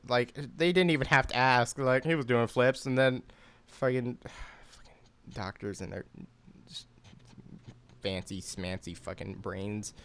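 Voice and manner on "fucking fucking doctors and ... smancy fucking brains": sarcastic muttered whisper. Real, not acted. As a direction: hiss it under your breath with contempt. Muttered jab at the doctors.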